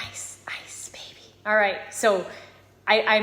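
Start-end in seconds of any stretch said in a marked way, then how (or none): 0.1-1.2 whisper. Acted. Teasing the song title.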